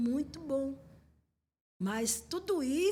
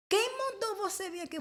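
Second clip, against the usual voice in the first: demon challenge; 10 semitones higher than usual. Retold or imitated.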